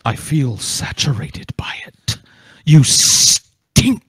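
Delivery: a hint of Agent Smith, close and menacing; half-whispered villain lines, lightly done; Agent Smith impression. Playing Agent Smith for his friends, lightly menacing impression. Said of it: "You're like the Agent Smith".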